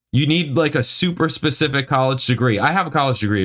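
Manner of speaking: high, indignant complaint; exasperated rant. Real, not acted. Reader complains the review needs a degree, exasperated. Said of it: "this makes me mad".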